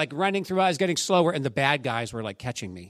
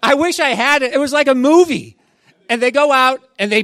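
excited; 8 semitones higher, 9 dB louder, faster than usual. Real, not acted. Preacher thrilled retelling the win.

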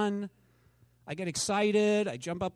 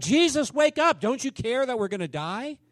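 mock panic of sinking disciples; panicked plea; 7 semitones higher than usual.

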